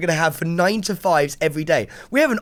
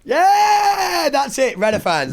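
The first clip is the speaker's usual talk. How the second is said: excited cheer; 10 semitones higher, 4 dB louder, much slower than usual. Real, not acted. Cheering at partner.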